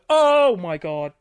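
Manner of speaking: loud slow shocked gasp. Real, not acted. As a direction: cry out in disbelief. Shocked mid joke.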